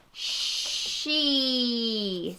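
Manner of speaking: slow drawn-out. Shown as a demonstration. A mom teaching her daughter, saying she slowly. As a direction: stretch every sound of the words out very slowly. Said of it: "we're gonna play Say It Fast".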